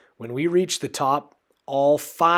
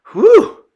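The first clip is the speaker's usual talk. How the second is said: loud whew; 13 dB louder than usual. A narrator's reading. Relieved exclamation.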